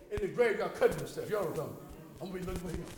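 ordinary talk

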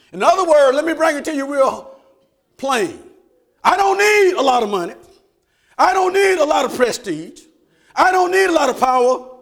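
loud, high rhythmic preaching; whooping preacher; full-force preaching. Acted. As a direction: drive it home to the congregation.

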